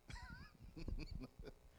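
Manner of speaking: a short high breathless laugh; breathy high laugh. Real, not acted. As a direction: laugh it off lightly. Laugh after line.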